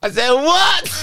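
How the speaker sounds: high laughing disbelief; incredulous what. Retold or imitated. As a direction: squeak it out in disbelief. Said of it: "I laughed".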